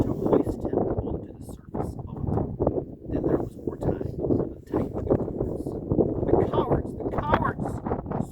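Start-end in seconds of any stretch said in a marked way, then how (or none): 6.2-8.3 slow, higher mild sob of outrage; a lightly voiced weeping girl; sobbing Susan. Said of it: "sobbed Susan".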